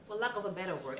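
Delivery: ordinary talk, nothing marked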